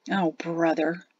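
soft murmur of disgust; understated disgust, said under the breath. A narrator's reading. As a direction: mutter it quietly, fed up. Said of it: "Jatesy murmured in disgust".

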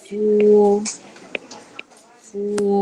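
sustained "ฟัว" on a level pitch; demonstrating pinyin sound. Shown as a demonstration. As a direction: draw out "ฟัว" evenly. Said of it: "ต่อไปค่ะ".

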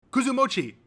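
high, loud blurted answer; eager contestant. A narrator's reading. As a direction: fire the answer out triumphantly.

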